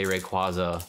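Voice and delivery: "Rayquaza" with an ah, "ray-KWAH-zuh"; "Rayquaza" with KWAH in the middle; habitual pronunciation. Shown as a demonstration. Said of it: "And I tend to".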